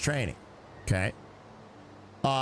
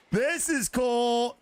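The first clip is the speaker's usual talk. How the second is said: higher, slow, drawn-out this is cool; excited repeat; 7 semitones higher, much slower than usual. Real, not acted. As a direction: stretch it out with delight. Repeats it after a laugh.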